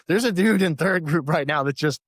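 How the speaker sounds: ordinary delivery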